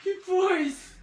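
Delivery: high frightened exclaim; scared horror game streamer. Real, not acted.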